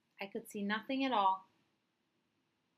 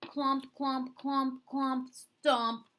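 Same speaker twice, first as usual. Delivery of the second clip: higher, heavy rhythmic clomps; marching boots imitation; 5 semitones higher, 4 dB louder, much slower than usual. Blind boy mimicking soldiers' boots, heavy rhythmic clomps.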